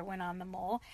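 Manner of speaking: ordinary delivery, nothing marked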